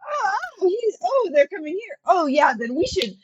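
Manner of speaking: sudden fear, urging everyone out; panicked alarm. Acted. Player hears the general is coming and panics, loud.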